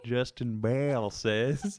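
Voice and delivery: put-on slow, laughing Southern accent; exaggerated southern accent. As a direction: drawl the name in a Southern accent. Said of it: "I'm overdoing it".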